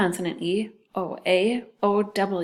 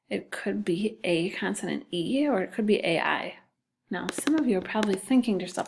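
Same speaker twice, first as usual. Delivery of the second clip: usual speaking voice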